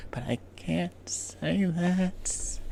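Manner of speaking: very soft, very slow sigh-like line; mock tragic hush. Sailor to his date, hushed and very slow. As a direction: breathe it out slowly, hushed.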